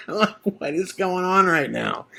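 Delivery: high, soft laugh-through question; laughing disbelief. Real, not acted. Storyteller reliving his friend bailing on the bill, laughing in disbelief. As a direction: laugh helplessly while asking it.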